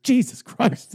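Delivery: high, loud shocked gasp of a line; shocked exclamation. Retold or imitated. Salesman hearing the dead wife joke, high shocked cry. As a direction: blurt it out appalled.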